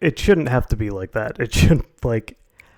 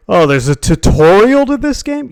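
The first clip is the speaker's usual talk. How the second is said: high, loud clueless surprise; playing dumb, overdone; mocking imitation; 9 semitones higher, 6 dB louder than usual. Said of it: "it's like".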